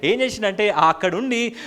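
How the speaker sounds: loud, high-pitched preacher's declamation; full-force preaching. Real, not acted. Preacher telling the congregation how Joseph wept aloud, in full-force preaching. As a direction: proclaim the story at the top of your register.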